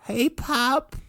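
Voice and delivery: a high, sheepish hey to dad; Lenny greeting. Retold or imitated. Lenny greeting his father.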